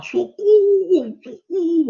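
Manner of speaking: high, loud, slow reenacted yell; retold cry for help. Teacher reliving calling for help while drowning. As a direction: call for help from far out at sea. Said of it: "E aí eu gritei lá".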